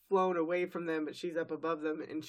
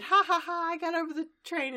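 high, loud gloating cackle, then quick; excited; 11 semitones higher, 4 dB louder than usual. Retold or imitated. Host voicing Applejack beating the train, gleeful high crowing. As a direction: crow with glee at winning. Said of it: "Applejack is so excited".

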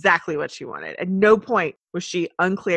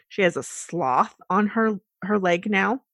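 ordinary talk, nothing marked

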